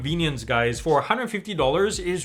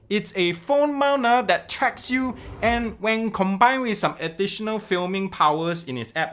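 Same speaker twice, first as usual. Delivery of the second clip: Singlish-flavoured Malaysian English, pitched higher; Malaysian Uncle Roger accent; 3 semitones higher than usual. Acted. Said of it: "do the intro in that accent".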